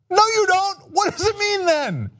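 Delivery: high mocking put-on voice; mock spokesperson.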